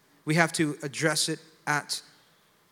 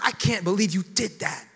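higher, reproachful exclamation; accusing disbelief; 4 semitones higher than usual. Shown as a demonstration. Confronting someone caught in sin the wrong way, accusing. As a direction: confront them as if shocked and judging. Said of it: "you say something like this. Not".

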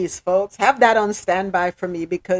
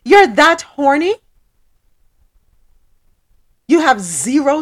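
indignant scolding; 5 semitones higher, 6 dB louder than usual. Acted. Scolding an imagined groper.

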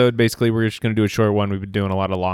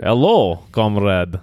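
a light hammed-up Russian accent; mock Russian accent; 3 dB louder, much slower than usual. Shown as a demonstration. Offering his Russian, a light mock Russian accent. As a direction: greet like a cartoon Russian. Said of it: "I could do the Russian".

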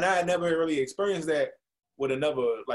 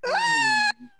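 a very high, loud, strangled squeal; startled squeal; 4 dB louder than usual. Retold or imitated. Stiff guy caught in a stranger's hug, squealing high. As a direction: squeak out in alarm. Startled while being hugged.

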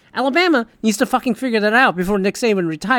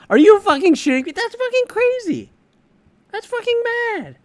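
incredulous; 13 semitones higher than usual. Real, not acted. Disbelief at forty million dollars.

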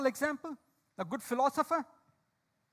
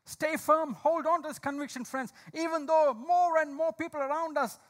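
louder, urgent preaching voice; fervent preaching; 4 semitones higher, 5 dB louder than usual. Acted. Preacher urging friends to hold firm, fervent preaching. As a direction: exhort the congregation to stand firm.

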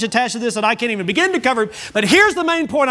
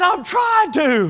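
high-pitched mock shout back; higher, strained comic protest; mock exasperated yell; 6 semitones higher, slower than usual. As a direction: imitate a flustered player calling back. Player yelling back at the crowd.

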